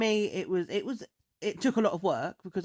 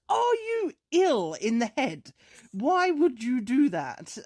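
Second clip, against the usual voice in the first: high, slow, emphatic scolding; mock-outraged mother, pitched up; imitated outraged mum; 6 semitones higher, 4 dB louder, slower than usual. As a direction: draw each word out as an appalled telling-off. Mum scolding boyfriend, retold.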